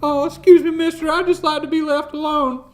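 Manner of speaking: high, loud, flustered excuse me; a jumpy loner shooing off a rider; yelped polite brush-off, high and loud; startled stranger. Retold or imitated. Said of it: "the guy's like".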